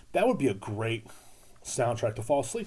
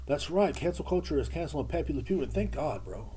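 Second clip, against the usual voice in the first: normal talking voice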